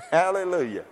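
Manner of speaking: hallelujah on a laugh. Real, not acted. Preacher glad to miss the destruction, laughing. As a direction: let a laugh carry into the praise.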